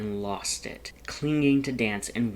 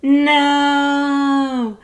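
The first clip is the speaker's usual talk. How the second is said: huge high wail of a no, much louder and higher; hammed-up self-disgusted no; 14 dB louder than usual. A narrator's reading. Skeleton wails at the whoopee cushion prank, hammed up. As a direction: ham up a theatrical cry of disgust. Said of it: "the most self-disgusted and hammed up".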